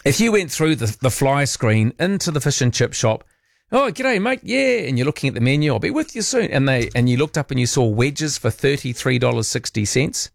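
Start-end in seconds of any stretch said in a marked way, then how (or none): 3.7-4.9 high, cheery Aussie shopkeeper greeting; chirpy shop owner. Retold or imitated. Voicing fish shop owner. 5.7-6.5 chirpy shop owner. Retold or imitated. Voicing fish shop owner.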